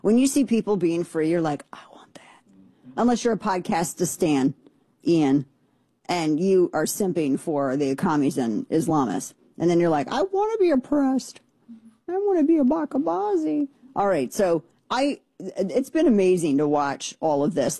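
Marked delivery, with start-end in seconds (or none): 1.7-2.4 hushed, voiceless whisper; acted awestruck whisper; whispered quote. Voices people seeing others being free. 10.1-13.7 high-pitched mock whine; mocking falsetto-like caricature; mocking imitation. Mocking imitation of people simping.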